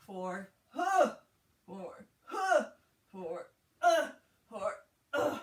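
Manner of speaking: counting out with effortful grunts; straining grunts. Real, not acted. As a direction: push through the effort, grunting. Counting reps during hard exercise.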